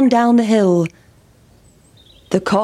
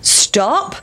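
farmer calling; 7 semitones higher than usual. A narrator's reading. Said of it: "said the farmer".

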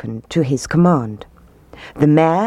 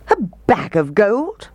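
indignant, higher-pitched outburst; mock roar; 5 semitones higher than usual. A narrator's reading. Mayor balking at the piper's demand for gold, indignant roar. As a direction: roar it back in disbelief. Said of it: "roared the mayor".

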